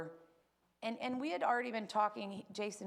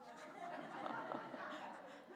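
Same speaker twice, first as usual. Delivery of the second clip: soft real laugh; 10 dB quieter than usual. Real, not acted. Laughing at her own talkativeness.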